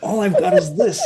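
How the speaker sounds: high, loud, exasperated outburst; acted indignant protest. Randy defends his only gun to the players, exasperated. Game master acts cornered Randy producing pistol.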